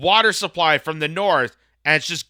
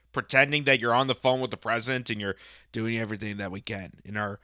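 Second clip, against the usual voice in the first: lower, soft radio-host mimicry; a hint of a calm NPR announcer, lower and softer; mock NPR voice; 6 semitones lower, 8 dB quieter than usual. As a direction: lightly imitate a hushed public radio host. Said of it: "in our great N P R voice".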